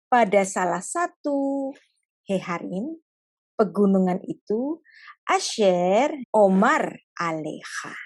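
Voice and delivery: very slow, drawn-out reading; God slowly giving a painful command; slow gentle. Acted. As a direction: spell out the heavy order slowly. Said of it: "Ngomongnya pelan-pelan".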